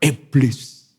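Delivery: "iblis" in a hearty East Javanese accent, opening like "eblis". Shown as a demonstration. Preacher showing how East Javanese say iblis, hearty East Javanese accent. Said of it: "Soalnya ngomongnya enak".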